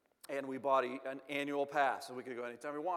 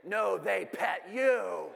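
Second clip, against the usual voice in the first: mock reply; 3 semitones higher, 5 dB louder, much slower than usual. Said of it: "It's not like I can say".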